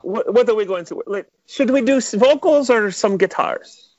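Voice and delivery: lightly imitating an eager producer asking; imitating Ivan. Ivan greeting Bubba in the studio, eager high voice. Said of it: "he would just be like".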